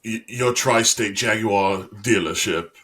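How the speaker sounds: put-on slow ad read with British accent, r's dropped; British-accented commercial. Imitating a car dealer commercial, slow British-accented ad read. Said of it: "Even, like, commercials".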